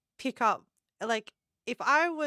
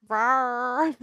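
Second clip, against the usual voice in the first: 1 mock rawr; 6 dB louder than usual. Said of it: "I'd be like".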